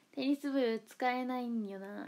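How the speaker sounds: slow, stretched テニス部使えないんよな; lingering, slow deliberation; drawn-out musing. Pretend. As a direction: drag it out as if mulling it over. Repeats a club gag, drawn out.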